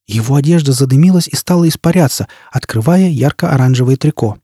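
usual speaking voice

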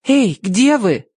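slow, high searching call; drawn-out high call. A narrator's reading. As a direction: call slowly for someone in hiding. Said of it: "позвала она".

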